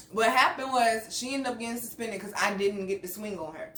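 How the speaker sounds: usual speaking voice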